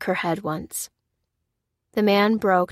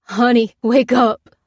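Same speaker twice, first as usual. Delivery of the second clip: loud, raised-pitch pleading; urgent call to wake; desperate plea; 4 semitones higher, 8 dB louder, slower than usual. A narrator's reading. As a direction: try desperately to rouse someone. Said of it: "desperately pawing at his wife's cheeks".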